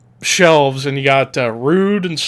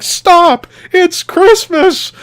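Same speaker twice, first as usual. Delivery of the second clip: much higher, louder, slow yell; mock shout; 5 dB louder, much slower than usual. AJ stopping a fight, hammed-up shout. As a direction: shout it to stop the brawl. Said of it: "is like".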